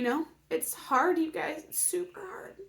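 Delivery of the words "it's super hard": choked up. Real, not acted. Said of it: "kinda makes me emotional".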